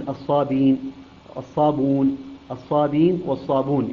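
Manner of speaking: الصابين and الصابون with no glottal stop; without hamza. Shown as a demonstration. Teacher showing Qalun's reading, الصابون without hamza. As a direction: glide through الصابين, الصابون, dropping the hamza. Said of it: "بدون همز".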